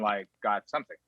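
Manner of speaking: ordinary delivery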